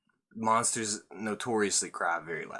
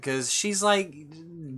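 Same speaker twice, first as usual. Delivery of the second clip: normal talking voice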